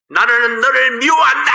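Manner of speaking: high, loud yell from a mountaintop; angry child yelling into the valley; child's shout. Retold or imitated. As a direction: shout it out across the hills. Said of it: "이렇게 소리를 쳤어요".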